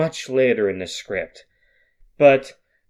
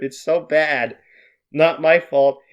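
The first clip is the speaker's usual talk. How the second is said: ordinary delivery, nothing marked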